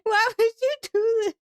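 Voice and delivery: high, helpless plea through laughter; laughing reproach. Real, not acted. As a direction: wail it at them while cracking up. Laughing, scolds chatter for joke.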